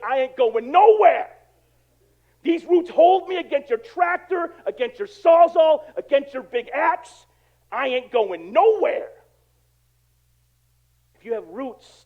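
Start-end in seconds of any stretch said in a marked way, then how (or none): 0.0-9.1 defiant tree. Acted. Preacher voicing a tree refusing to be dug up, loud and defiant. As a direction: dig in and boom it out defiantly. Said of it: "That tree's like".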